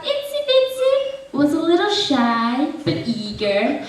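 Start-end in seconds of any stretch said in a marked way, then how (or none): 0.0-0.9 put-on high-pitched babysitter. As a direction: pop into a squeaky mimicked voice for the name. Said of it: "talked in a high-pitched voice".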